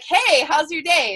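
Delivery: bright, high, slow mock-cheery hello; cheerful greeting. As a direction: put on a big smile and sing it out. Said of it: "I'm gonna put on my smile".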